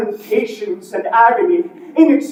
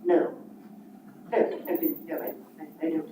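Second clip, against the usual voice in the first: quiet stammering Scrooge; frightened old miser, stammering; playing both parts; 6 semitones lower, 13 dB quieter, faster than usual. Scrooge denies the ghost.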